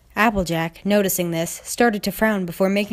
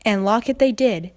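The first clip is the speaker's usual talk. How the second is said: ordinary delivery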